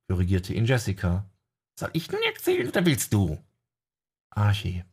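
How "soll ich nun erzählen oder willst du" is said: higher voice of a companion; high character voice. Acted. Companion asking Archie, higher voice. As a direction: pitch up for the companion.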